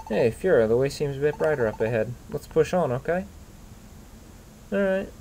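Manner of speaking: a hint of an eager Pokémon partner; hero's partner voice. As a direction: read it as the upbeat sidekick. Partner dialogue read aloud.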